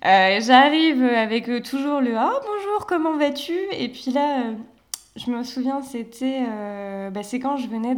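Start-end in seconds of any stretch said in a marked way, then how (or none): 2.2-3.5 «bonjour» lifted much higher, casual; breezy greeting. Retold or imitated. Recalling her friendly hello as a newcomer, breezy. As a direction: toss off a cheerful casual hello.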